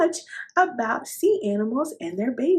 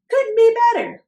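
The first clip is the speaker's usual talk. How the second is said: cheerful reply; 14 semitones higher, 10 dB louder than usual. Acted. Mister Pipe cheerfully answers.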